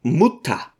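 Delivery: a curt, irritated Mutter; angry interruption. A narrator's reading. Son cutting off his fussing mother, angry. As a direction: cut his mother off in anger. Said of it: "unterbrach sie der Lehrer zornig".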